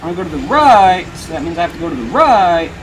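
loud complaint, "right" soaring up; exasperated. Real, not acted. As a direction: wail "right" in frustration. Struggling with steering in game.

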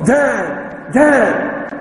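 put-on high childlike calls of Dad; child calling. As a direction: call out like a toddler wanting attention. Young child calling father.

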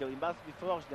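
usual speaking voice

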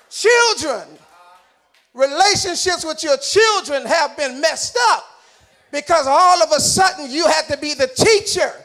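loud, punched sermon delivery; full-force preaching. Real, not acted. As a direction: preach it loud, driving each phrase home.

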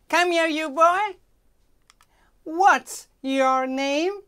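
high, loud bossy maid voice; maid calling boy. Acted. Maid summoning the boy, high and loud. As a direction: call the boy over sharply.

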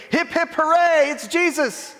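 high-pitched crowd cheer; mock cheer. What the crowd weren't saying to Jesus, acted high cheer. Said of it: "they weren't saying".

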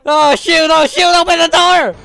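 loud, high panicked yells; panicked shouting. Real, not acted. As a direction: yell for your life. Panicked at being attacked.

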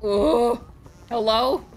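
ordinary delivery

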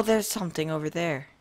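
ordinary talk

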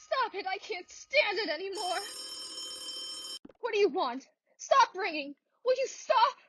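high, desperate pleading; hysterical plea. Acted. Terrified woman begging the phone to stop, high and desperate. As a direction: beg the ringing to stop.

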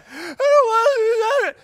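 very high, loud crying whine; whiny kid. Retold or imitated. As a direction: wail it like a sulking child. Child whining about chore.

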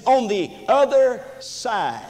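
"other side" set apart with deliberate weight; stress on "other side". Shown as a demonstration. Preacher pointing out the key phrase to congregation, emphatic "other side". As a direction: lean slowly and firmly on "other side". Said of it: "when you place emphasis".

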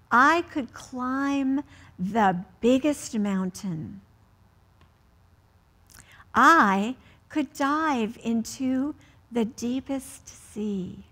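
slow, careful picture-book read-aloud; reading to children. Acted. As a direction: read each line slowly to a child at bedtime.

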